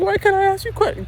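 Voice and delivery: very high indignant squeak; squeaky mock indignation; mock offended girlfriend. Mimicking girlfriend.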